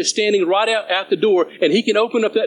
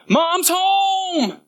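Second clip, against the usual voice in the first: excited yell; 12 semitones higher than usual. Retold or imitated. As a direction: yell it out as a warning. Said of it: "and he yelled out".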